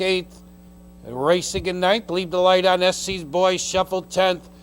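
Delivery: ordinary talk, nothing marked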